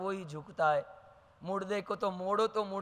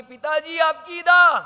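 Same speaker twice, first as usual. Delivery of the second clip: higher, loud urgent call; son calling out; 7 semitones higher, 10 dB louder than usual. Acted. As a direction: blurt it out to interrupt father. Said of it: "बेटा के". (बेटे की ऊँची, तेज़ पुकार)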